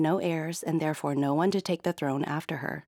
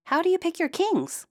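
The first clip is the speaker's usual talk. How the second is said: much higher, wry question; curious princess; 13 semitones higher than usual. Acted. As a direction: princess asking dryly, high. Princess voice in story.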